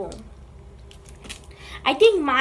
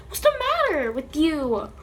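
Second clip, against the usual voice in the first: ordinary talk, nothing marked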